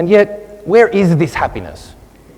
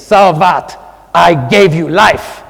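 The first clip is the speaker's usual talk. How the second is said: put-on imperial Italian accent; 3 semitones higher, 7 dB louder, much slower than usual. Mother scolding her son, imperious Italian accent. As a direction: lay down the law in a grand Italian accent. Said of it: "in her im-, imperial Italo-Italian accent".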